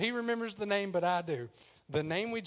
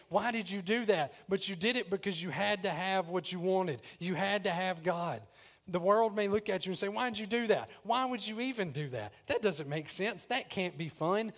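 preaching; 4 semitones higher than usual. Acted. Pastor urging the church to desire God, preaching. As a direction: pitch lifted, building sermon delivery.

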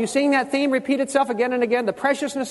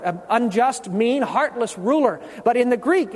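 usual speaking voice

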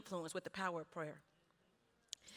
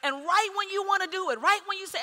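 loud, raised-pitch sermon delivery; emphatic preacher building in punchy contrasts; full-force preaching; 5 semitones higher, 9 dB louder than usual. Real, not acted. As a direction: drive each point home to the congregation, loudly.

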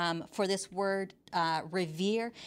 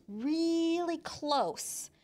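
REALLY drawn way out, higher pitched; drawn-out emphasis; 8 semitones higher, much slower than usual. Real, not acted. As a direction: stretch REALLY out high and long. Preacher stretches the phrase for effect.

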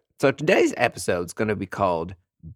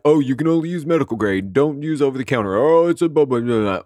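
mock snob; 3 semitones higher, 4 dB louder than usual. Imitating skincare snobs.

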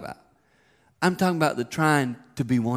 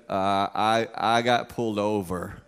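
lower, slow guilty confession; sheepish son; 5 semitones lower, slower than usual. Retold or imitated. Son confessing second ticket, sheepish. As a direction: admit it reluctantly. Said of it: "He goes".

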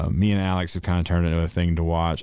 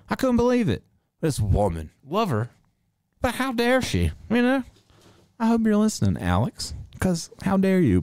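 mock outrage; 11 semitones higher than usual.

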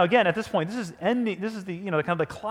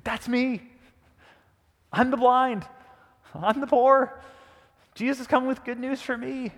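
higher-pitched, mounting excitement; excited realization; 7 semitones higher than usual. Retold or imitated. Blind beggar realizing the promise is for him, excited. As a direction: blind man seizing on good news.

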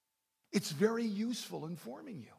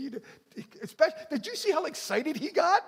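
ordinary talk

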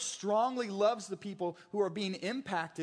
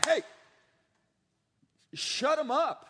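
abrupt, louder and higher order; sharp command; 8 semitones higher, 5 dB louder than usual. Retold or imitated. Preacher voicing Paul's blunt order, loud and sharp. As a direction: bark it like a shut-down. Paul silencing false teachers.

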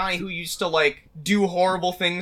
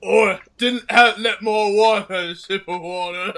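put-on king voice. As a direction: groan it out like a hammed-up old king. Replies in the King Henry joke voice.

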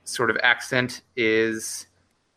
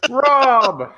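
mock heroic shout; 14 semitones higher, 6 dB louder than usual. Mocking the name.

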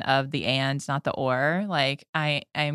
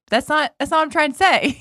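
exasperated protest; 11 semitones higher, 7 dB louder than usual. Retold or imitated. Retold reply to husband.

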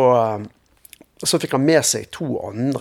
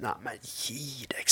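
much softer, slow, breathed disbelief; astonished whisper; 14 dB quieter, slower than usual. Retold or imitated. Answering the preacher in whispered disbelief. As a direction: whisper it as if it can't be true. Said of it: "sa jeg".

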